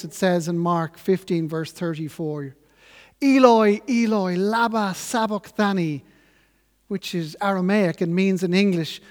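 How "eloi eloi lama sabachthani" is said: reciting a cry from the cross, first word raised high and loud, then easing. Retold or imitated. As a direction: call out the first word strongly, like a quoted plea. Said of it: "he cries out in a loud voice".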